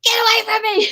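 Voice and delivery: reenacting yelling off a stranger in fear; retold scream. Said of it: "I started screaming".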